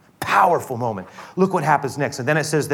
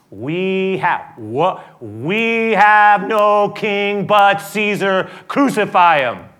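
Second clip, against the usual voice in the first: slow, raised, loud declaration; defiant cry; 7 semitones higher, 7 dB louder, much slower than usual. Acted. The crowd disowning Jesus before Pilate, slow defiant cry. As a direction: proclaim it forcefully as the crowd.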